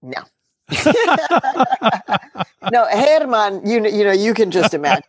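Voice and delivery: amused, laughing refusal; high laugh, then a laughing no. Real, not acted. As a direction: laugh off the idea. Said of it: "Have you ever considered putting your name on a ballot".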